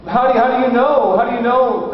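high, hesitant, repeated question; careful father. Retold or imitated. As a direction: imitate a dad gingerly probing his child. Father delicately questions daughter.

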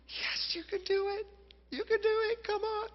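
high, squeaky, held-back cheer; hushed cheering. Retold or imitated. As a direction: urge her on in a small falsetto. Father urging on his submerged child.

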